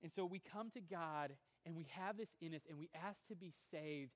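normal talking voice

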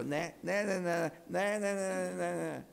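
put-on high sing-song kid taunt, covering ears; mock a stubborn child refusing to listen; childish nah-nah. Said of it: "like the little kids who don't want to hear it".

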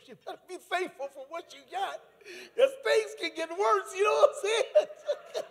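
high, loud laughing exclamation. Real, not acted. Preacher warning to watch your prayers, laughing. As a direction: squeal it out through laughter, pitch climbing. Joking aside, laughing at own story.

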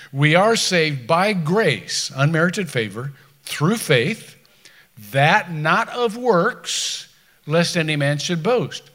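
loud, slow scripture proclamation; forceful preaching. Acted. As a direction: proclaim it to the back row. Preaching Ephesians at full force.

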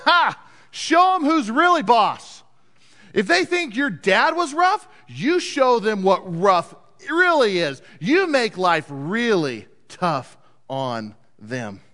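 swaggering buddies, ending slow and emphatic; cocky goading. Acted. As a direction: egg him on, then slow down for weight. Cocky buddies egg on the king.

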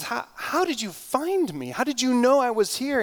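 put-on amazed boy. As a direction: pitch it up as a startled young boy.

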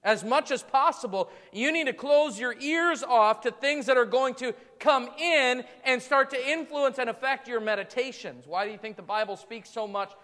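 rising sermon fervor; full-force preaching. Acted. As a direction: drive the point home to the congregation.

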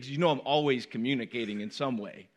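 normal talking voice

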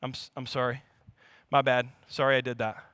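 low, soft, offhand sorry; poor apology. Shown as a demonstration. Husband fixing it with a not-great apology, offhand and low. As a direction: toss off a half-hearted apology, low and quiet. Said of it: "Here is not a great apology".

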